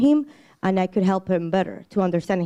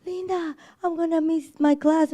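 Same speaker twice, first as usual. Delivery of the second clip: put-on high whiny kid-brother voice, pitched way up; high, whining kid on the phone; imitating crying kid; 8 semitones higher than usual. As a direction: mimic a little brother wailing down the phone. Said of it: "he calls me crying".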